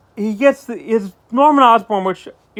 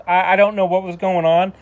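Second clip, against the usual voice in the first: ordinary talk, nothing marked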